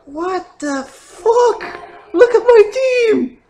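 rising to a high, loud cry of disbelief; shocked outburst. Real, not acted. Gamer yelling at his wiped-out team in shock. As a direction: yell in real shock.

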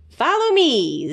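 high, bright-eyed little critter voice; high eager sidekick calling a friend; Zeke the little creature. A narrator's reading. Zeke's voice in the story.

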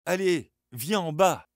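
high, loud, eager call downstairs; bursting with excitement, calling him down; trembling with excitement. Acted. Suzanne calls her brother downstairs, trembling with excitement. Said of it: "tremblant d'excitation".

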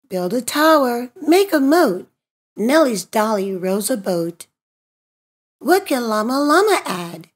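usual speaking voice

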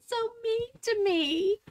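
fake-cry it for sympathy; mock cry. Complaining to viewers about her cat, a mock whimper.

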